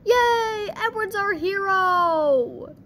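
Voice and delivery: high, loud, drawn-out cheering; playful hero's hurrah; mock cheer. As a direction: cheer it out, stretching the last word.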